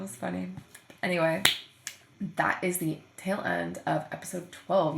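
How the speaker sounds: usual speaking voice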